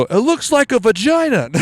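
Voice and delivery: high-pitched mock shout from the back; hammed-up heckle in a high voice; imitated onlooker. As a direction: call it out as a high-voiced bystander. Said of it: "I just hear someone in the back go".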